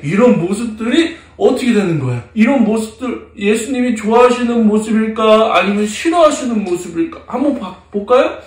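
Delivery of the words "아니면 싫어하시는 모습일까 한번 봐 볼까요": ordinary delivery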